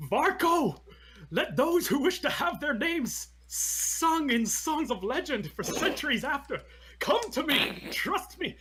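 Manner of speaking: high, loud, drawn-out war cry; rallying battle yell. Acted. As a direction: bellow a rousing speech to your warriors. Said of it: "so I'll yell out".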